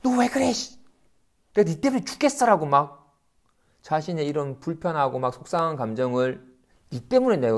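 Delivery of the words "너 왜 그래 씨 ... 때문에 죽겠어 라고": high, imitated snapping blame; mock angry outburst. An upset person blaming someone else, imitated angry outburst. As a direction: blame someone in a retold temper. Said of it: "제정신을 잃고서".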